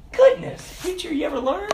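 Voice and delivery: high-pitched scandalized congregant act; mock scolding. Congregant asking if the preacher ever learns, mock scolding.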